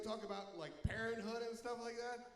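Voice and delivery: normal talking voice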